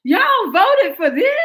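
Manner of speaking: high, loud and slow, in a put-on voice; mock indignant sister. Voicing the sister scolding the audience, loud scandalised mock. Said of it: "She's like".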